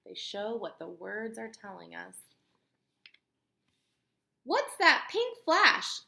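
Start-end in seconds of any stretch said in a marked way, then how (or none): 4.4-6.0 high, loud dramatic question; read-aloud excitement; excited wonder. Acted. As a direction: make the kids gasp. Dramatic question, storybook reading.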